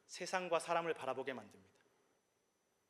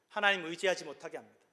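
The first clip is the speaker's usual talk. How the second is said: 하나님 의지하지 못하게 합니다 said louder, 하나님 hit hard with a falling close; preacher warning the church, louder and firm; emphatic warning; 3 semitones higher, 8 dB louder than usual. Acted. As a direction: hammer 하나님 and land the line firmly. Phrase repeated with force.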